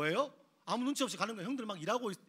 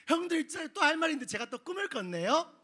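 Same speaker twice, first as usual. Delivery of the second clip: high, louder sing-song announcement; chirpy clueless brother; 8 semitones higher, 5 dB louder than usual. Acted. Joseph skipping up to brothers with another dream, high. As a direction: breeze in oblivious and cheerful.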